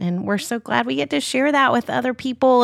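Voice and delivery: ordinary talk, nothing marked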